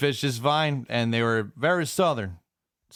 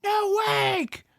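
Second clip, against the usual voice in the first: very high, drawn-out yell; screaming neighbour. Retold or imitated. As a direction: holler it from a deck across the water. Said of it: "He screams".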